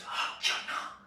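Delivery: hushed whisper. Retold or imitated. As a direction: whisper back quickly. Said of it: "Munni सब कह रहे".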